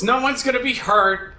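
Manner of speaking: ordinary talk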